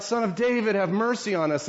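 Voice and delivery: high pleading call for mercy; blind men shouting. Acted. Blind men calling after Jesus, pitched high and pleading. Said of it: "they keep shouting out".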